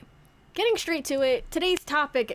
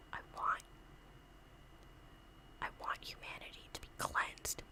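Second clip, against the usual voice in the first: low, much softer whispered villain puppet; sinister puppet whisper; 18 dB quieter than usual. Pretend. Puppet Disgusto whispering her wish to Santa. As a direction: hiss the wish like a tiny doom-bringer.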